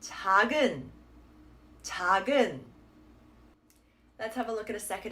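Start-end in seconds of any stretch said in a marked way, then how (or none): 0.0-2.6 작은 joined up as ta-gun, a single g; ta-gun without the pause; quicker, carried on. Shown as a demonstration. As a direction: say 작은 as one smooth word, g starting the second syllable. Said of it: "you can say it a little bit quicker".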